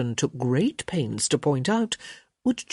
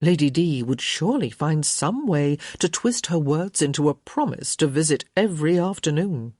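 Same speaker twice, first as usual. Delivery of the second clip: plain reading voice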